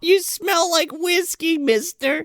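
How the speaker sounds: put-on high, slow little girl voice; mock little girl. Little girl answering the henchman, high childlike voice. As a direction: pipe it up like a small child.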